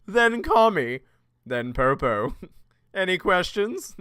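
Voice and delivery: put-on cartoon voice with chuckles; Mister Popo. Character ends the pecking order, hammed-up parody voice. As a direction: finish the ranking as a challenge. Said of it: "Mister Popo was probably my favorite".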